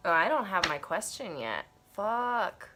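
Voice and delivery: normal talking voice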